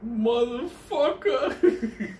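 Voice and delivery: high, slow, broken-up curse; wounded kid. Retold or imitated. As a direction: drag it out, pitched high and half laughing. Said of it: "this fucking kid was like".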